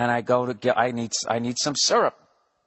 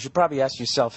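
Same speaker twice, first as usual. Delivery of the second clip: usual speaking voice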